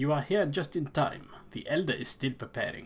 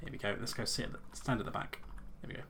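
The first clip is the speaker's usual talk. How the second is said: ordinary delivery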